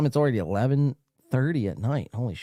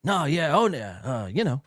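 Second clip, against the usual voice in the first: normal talking voice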